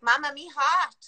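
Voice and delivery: a hint of a whiny toddler; lightly voiced sick child; little child. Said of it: "they're like".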